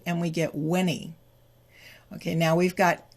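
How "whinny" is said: WHINNY for "when he", H dropped; blended WHEN HE. Shown as a demonstration. Teacher blends for learners, "when he" as WHINNY. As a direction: link N onto "ee" to make WHINNY. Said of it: "moves to the E".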